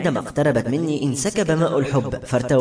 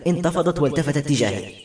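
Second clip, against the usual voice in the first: usual speaking voice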